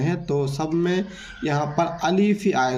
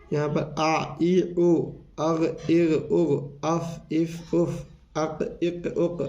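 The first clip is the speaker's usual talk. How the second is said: slow syllable drill; much slower than usual. Shown as a demonstration. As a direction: drill the sets slowly and clearly. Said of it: "आप इसको अस इस उस भी पढ़ सकते हैं".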